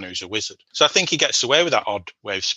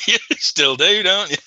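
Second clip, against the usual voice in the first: laughing; 10 semitones higher, 4 dB louder than usual. Real, not acted. Teasing brother, laughing.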